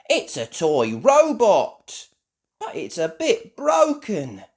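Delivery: high, loud, slow exclamation; surprised. A narrator's reading. Children finding a toy robot, surprised. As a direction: announce the discovery with wonder. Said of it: "The children gasp, surprised".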